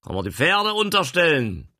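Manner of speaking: loud, high call from the wagon. Acted. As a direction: call out over the yard. Gentleman shouting from wagon.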